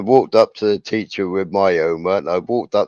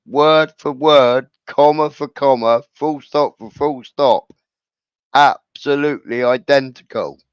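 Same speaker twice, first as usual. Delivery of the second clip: emphatic retold protest; 5 semitones higher, 4 dB louder than usual.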